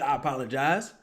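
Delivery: ordinary delivery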